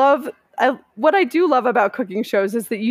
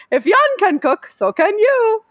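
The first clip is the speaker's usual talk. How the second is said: much higher, louder show-host sign-off; TV chef catchphrase; 12 semitones higher, 4 dB louder than usual. Retold or imitated. Imitating the cooking host's sign-off to viewers, high and bright. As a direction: sing out the catchphrase like a cheery TV chef. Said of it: "he always does this".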